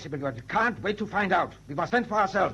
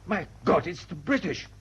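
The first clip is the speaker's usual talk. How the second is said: ordinary talk, nothing marked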